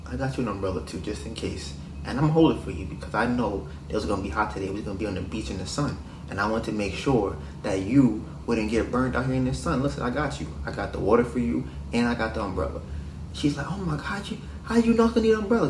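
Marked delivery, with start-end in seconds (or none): 0.1-12.8 soft, low smooth-talker; reassuring boyfriend. Acted. Acting the attentive boyfriend reassuring her. 13.7-15.7 high-pitched, softly incredulous; amazed girlfriend. Acted.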